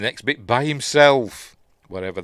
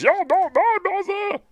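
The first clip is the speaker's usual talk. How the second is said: high, loud, slow scream of denial; shrieking you're not my mother; furious scream; 6 dB louder than usual. Retold or imitated. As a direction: scream it right at her face, slowly. Priest screams at possessed girl.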